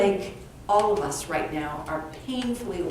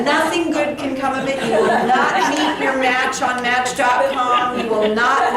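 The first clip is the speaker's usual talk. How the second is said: laughing; 4 semitones higher, 7 dB louder than usual. Real, not acted. Joking warning, laughing.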